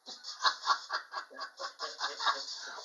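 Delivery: long, breathy-free burst of laughter; a long run of chuckles; real laugh. Real, not acted. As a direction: laugh heartily at a joke. Listener laughs at the joke.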